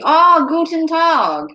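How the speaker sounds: high, drawn-out German greeting; German accent. Acted. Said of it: "my poor German accent".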